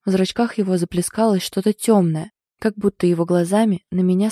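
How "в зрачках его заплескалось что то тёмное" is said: ordinary delivery, nothing marked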